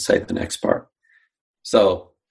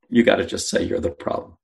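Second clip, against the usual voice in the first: ordinary talk, nothing marked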